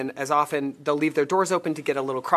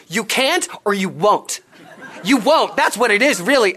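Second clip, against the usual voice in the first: loud, higher scolding; chewing him out; 5 semitones higher, 6 dB louder than usual. Retold or imitated. New missionary chewing out the man who won't convert, loud scolding. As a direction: scold him as if he were lying. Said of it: "And I was like".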